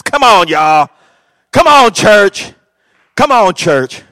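loud, slow call to the church; rally the church, loud. Real, not acted. Preacher calling to congregation.

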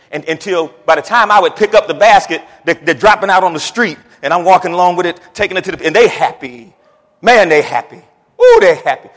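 loud, building, punched storytelling; excited preaching. Real, not acted. Preacher telling congregation of delighted customers, loud and excited.

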